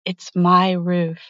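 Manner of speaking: ordinary talk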